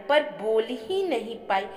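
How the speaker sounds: plain reading voice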